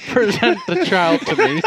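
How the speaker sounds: hammed-up stilted nobleman; mock formal demand. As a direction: play it like a stiff royal, through laughter. Mocking awkward guy's formal request.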